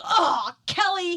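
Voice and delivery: loud, high, drawn-out groan of reproach; exasperated husband. Retold or imitated. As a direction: imitate a spouse fed up with a prank. Husband exasperated after scare, retold.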